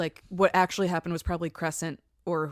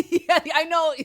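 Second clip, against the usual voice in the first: high, loud laughing agreement; 11 semitones higher, 12 dB louder than usual. Real, not acted. Co-host cracking up at him passing out, laughing.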